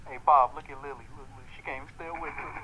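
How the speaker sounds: low, lazy dubbed voice for the animal; deep dubbed pet voice. Acted. Dubs a pet's voice.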